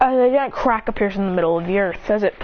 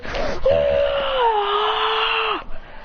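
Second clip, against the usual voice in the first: long, high strained yell; high sustained power-up cry; acted power scream; 10 semitones higher than usual. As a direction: scream while growing gigantic. Hero screams while growing.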